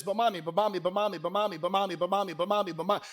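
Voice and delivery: imitation of a child's relentless begging; sing-song mock pestering; nagging child. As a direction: chant it on a loop without stopping. Child nagging mom, repeated without let-up.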